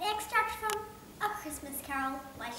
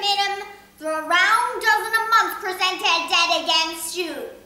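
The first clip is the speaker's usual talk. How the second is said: louder, higher mocking rant; ranting Scrooge; 5 semitones higher, 13 dB louder than usual. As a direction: build the scorn to a peak.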